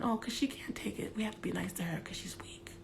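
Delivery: hushed, mocking whisper of what others say. Whispering a mock of people pitying the weak one. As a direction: whisper it like a sneering aside.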